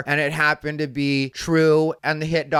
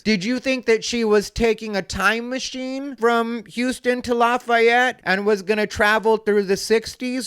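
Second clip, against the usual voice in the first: sarcastic disbelief; 6 semitones higher than usual. Real, not acted. Sarcastic jab at commenter.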